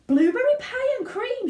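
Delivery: high, loud excited cry; shouted. Acted. Violet announces dessert to the room, shouting. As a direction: crow it out in delight. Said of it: "shouted Violet".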